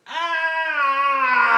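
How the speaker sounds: high loud shout of pain; pained yelp. Retold or imitated. Date crying out as the car door hits her leg, loud pained yelp. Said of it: "All I heard was".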